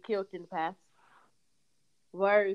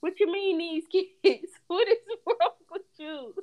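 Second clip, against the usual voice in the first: much higher, half-laughing disbelief; incredulous; 9 semitones higher, 3 dB louder than usual. Retold or imitated. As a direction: squeal it out in laughing disbelief at the son. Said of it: "I'm like".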